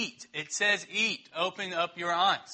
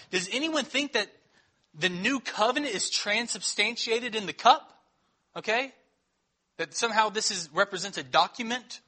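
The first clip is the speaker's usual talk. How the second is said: usual speaking voice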